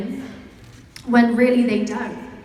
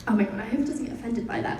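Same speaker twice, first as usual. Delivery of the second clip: usual speaking voice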